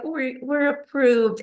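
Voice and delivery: slow, halting retold announcement; re-enacted emotional good news, drawn out; overcome with emotion. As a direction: get the news out slowly through mild emotion. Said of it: "you're too emotional to say anything".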